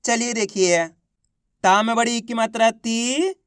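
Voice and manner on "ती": ती held long on a rising tune; drawn-out long ī. Shown as a demonstration. Teacher starting next word for class to repeat, drawn out. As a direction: stretch out the ī of ती. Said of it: "खींच के पढ़ा जाता है".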